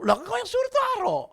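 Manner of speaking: high, loud retort; loud protest. Retold or imitated. Maid protesting to her boss, high and loud. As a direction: fire it back at him.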